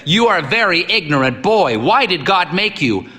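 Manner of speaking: high, booming, lecturing; stern old priest. A narrator's reading. Old priest lecturing the boy on prayer, booming. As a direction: preach at the boy.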